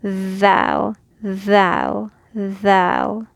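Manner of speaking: thou with the tongue tip out between the teeth; voiced th sound. Shown as a demonstration. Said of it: "the vibration will go".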